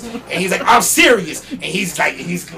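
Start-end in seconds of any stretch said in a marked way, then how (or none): 0.6-1.4 loud, higher imitation of an angry insistence; getting mad. Rapper missing his phone to his roadie, imitated getting mad. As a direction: insist loudly, temper rising. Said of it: "he's getting mad".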